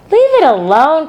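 high, loud order; sharp command. A narrator's reading. Fern commanding Avery to leave the spider, loud. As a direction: stop him cold. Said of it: "commanded Fern".

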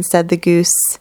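plain reading voice

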